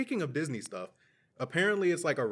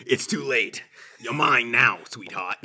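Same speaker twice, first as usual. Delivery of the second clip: loud, punched claim that drops off on the endearment; mock villainous seductress; 8 dB louder than usual. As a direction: play a triumphant captor, hammed up. Acts seductress answering the panic.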